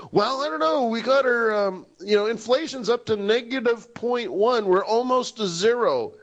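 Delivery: high-pitched dithering official; comic high-voiced excuse; mock policymaker. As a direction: put on a high voice, mocking an official. Acting out a hesitant policymaker.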